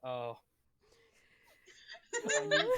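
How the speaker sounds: usual speaking voice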